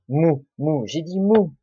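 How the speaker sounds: mou said alone and repeated, then mou stressed high; syllable isolated. Shown as a demonstration. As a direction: pull out the first syllable mou and hammer it.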